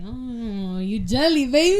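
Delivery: put-on teasing, rising loud on baby. Nikolai teasing jealous Brandon, loud teasing lilt. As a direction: needle him, sing-song. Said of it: "Nikolai is like".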